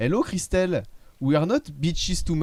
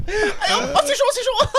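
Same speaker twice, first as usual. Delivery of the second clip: very high, loud, laughing host; Cyril Hanouna impression; 6 dB louder than usual. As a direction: hype the crowd, laughing. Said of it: "cinq secondes de Cyril Hanouna".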